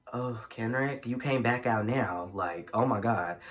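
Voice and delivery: low, soft inner-thought voice; hushed retold aside. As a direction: say it softly and low, as if to yourself. Retold thought to Kendrick mid-story.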